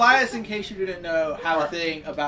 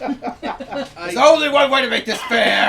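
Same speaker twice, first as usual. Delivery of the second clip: laughing shout; 5 semitones higher, 11 dB louder, much slower than usual. Acted. Player shouts in character, laughing.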